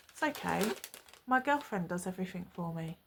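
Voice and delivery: soft, low, casual delivery; offhand retold reply. Boyfriend casually admits he has a girlfriend, offhand and soft. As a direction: drop it quietly, as if it's nothing. Retelling his blasé reply.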